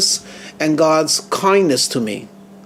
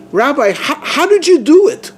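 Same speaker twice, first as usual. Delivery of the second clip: astonished question; 9 semitones higher, 4 dB louder than usual. Retold or imitated. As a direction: pitch leaping high on a stammered, amazed question. Hysterical free thinker questions the rabbi.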